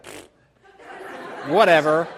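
scoffing puff, then an offhand reply; mock dismissal. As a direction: blow off the threat with a dismissive pfft. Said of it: "And they're like".

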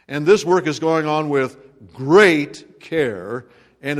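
slow down and lean on great care; great care stretched for weight; stress on great. Shown as a demonstration. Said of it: "I emphasize that word great".

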